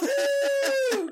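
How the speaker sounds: high whooping cheer; excited whoop. Real, not acted. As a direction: let out a sudden squealing woo. Cheering the wolf's arrival.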